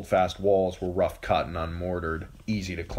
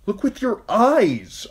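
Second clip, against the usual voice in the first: shout; 8 dB louder, slower than usual. Acted. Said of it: "Arya wanted to shout".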